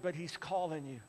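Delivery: ordinary delivery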